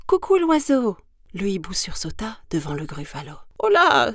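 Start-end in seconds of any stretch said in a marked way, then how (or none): 0.1-1.0 high, loud greeting; high-pitched mouse hello; mouse calling out. Acted. Mouse hails the owl. 3.6-4.1 high, loud yelp; startled owl's high cry; startled exclamation. Acted. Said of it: "s'exclama-t-il".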